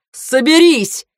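high, forceful order; commanding shout. A narrator's reading. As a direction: shout to snap someone out of a daze. Yelling at dazed soldier.

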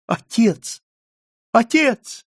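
high, rising cries of alarm; alarmed calling. A narrator's reading. Margarita calling to her collapsing father, alarmed.

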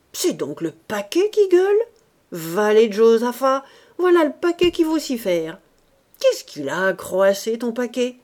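indignant astonishment. Acted. Man discovers the bundle is bawling, indignant and astonished. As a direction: rail at it in theatrical outrage.

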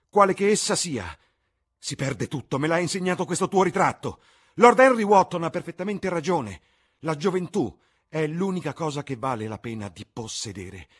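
put-on high, loud, agitated young man spiralling; irritated Dorian. A narrator's reading. Dorian raging at Basil over the portrait, loud and irritated. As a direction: lash out with rising bitterness. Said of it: "Sembrava molto irritato".

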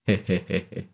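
short, restrained heh-heh laugh; chuckling. A narrator's reading. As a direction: let out a brief run of chuckles. Laugh closing a resolute thought.

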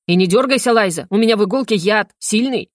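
usual speaking voice

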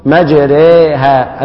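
"مجريها" very slowly, the ra vowel tilted toward e; slow teaching model of "مجريها"; drawn-out imala. Shown as a demonstration. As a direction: stretch "مجريها" syllable by syllable with a leaning ra. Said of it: "الكلمة المرادة الحفص التي نقرأها هكذا".

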